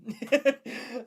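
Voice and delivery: high loud laugh. Real, not acted. Streamer laughing at retold story, loud laugh. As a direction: let the laugh burst out.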